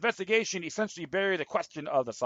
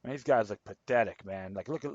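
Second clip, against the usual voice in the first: ordinary talk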